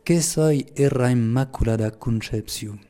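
phrase en patois de Lourdes, dite lentement; slow, deliberate Lourdes patois. Shown as a demonstration. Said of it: "je vais le dire en patois". (en patois gascon, lentement)

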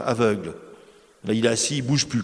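ordinary delivery

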